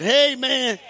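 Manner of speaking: high, loud, delighted hey man; excited greeting. Real, not acted. Greeting a church member warmly.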